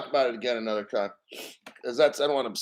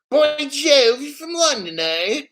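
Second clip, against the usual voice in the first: high, loud mock-London accent drifting toward Australian; botched London accent; 11 semitones higher, 5 dB louder than usual. Host greeting a viewer from London, in a botched London accent. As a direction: put on a bad, over-pitched London accent. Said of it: "I can't get my accent straight".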